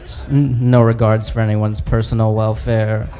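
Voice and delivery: low, halting, embarrassed confession; Hulk. Acted. Hulk admitting his powers to the other heroes, low and sheepish. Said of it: "he's talking to Thor and Captain America".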